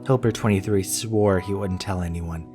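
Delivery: plain reading voice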